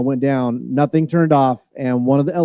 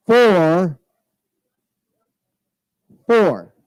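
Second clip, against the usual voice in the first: raffle caller; much slower than usual. Real, not acted. Final winning ticket call.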